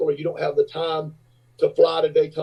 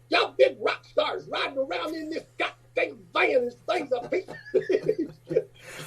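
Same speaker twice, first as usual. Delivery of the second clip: high, slow, soft put-on griping; drawn-out high imitation of a disgusted little passenger; little man complaining; 10 semitones higher, 6 dB quieter, slower than usual. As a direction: grumble in a small high voice, ending in laughter. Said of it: "he was standing straight up in that van".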